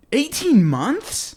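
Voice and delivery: high, slow, disbelieving; surprised. Real, not acted. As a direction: stretch it out in disbelief. Shocked at the projection.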